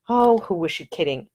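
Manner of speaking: ordinary delivery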